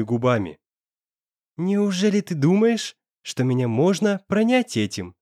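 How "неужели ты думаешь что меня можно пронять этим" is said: mocking. A narrator's reading. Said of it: "насмешливо произнёс он".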